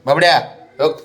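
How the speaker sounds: ordinary talk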